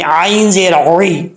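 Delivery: slow "आइन ज़ेर औई" with pharyngeal ayn, not hamza; careful, slow spelling, strong ayn in "औई"; throat ayn. Shown as a demonstration. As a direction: draw out "औई" from a squeezed throat. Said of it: "हमजे की आवाज़ नहीं पढ़नी चाहिए".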